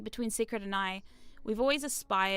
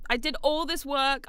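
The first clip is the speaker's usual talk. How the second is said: ordinary talk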